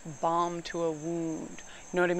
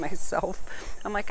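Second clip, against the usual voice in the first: usual speaking voice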